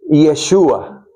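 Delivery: Yeshua said slowly as yeh-SHOO-ah; Hebrew pronunciation. Shown as a demonstration. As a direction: give the modern Hebrew Yeshua carefully. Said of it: "En hebreo moderno se dice".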